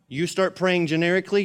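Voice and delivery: ordinary talk, nothing marked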